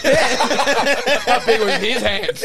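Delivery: high, loud, drawn-out question through laughter; laughing too hard to ask it straight. Real, not acted. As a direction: crack up while stretching out the question. Cracking up at own joke.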